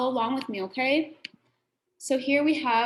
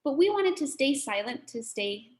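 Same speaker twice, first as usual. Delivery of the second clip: normal talking voice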